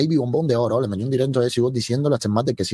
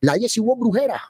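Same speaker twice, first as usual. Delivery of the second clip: mocking; 8 semitones higher than usual. Host mocking Jessie Ward as a witch. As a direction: stretch brujera out in scorn.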